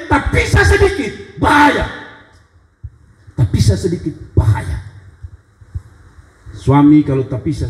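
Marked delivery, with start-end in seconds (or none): 0.1-1.9 emphatic warning. Shown as a demonstration. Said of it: "Apa artinya?".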